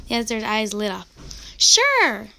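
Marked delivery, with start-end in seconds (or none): 1.6-2.3 high, loud, eager "Sure"; thrilled yes from a boy; excited exclaim. A narrator's reading. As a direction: burst out with delight. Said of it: "Eliezer exclaimed".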